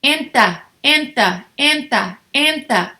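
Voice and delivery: enta drilled four times, even and falling; repeating the ending. Shown as a demonstration. Teacher drills the ending enta, evenly repeated. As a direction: tap out enta like a chant. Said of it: "N T A".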